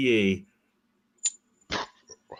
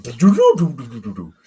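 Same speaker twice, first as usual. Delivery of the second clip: imitated back giving out; 3 semitones higher, 8 dB louder than usual. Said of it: "my back went".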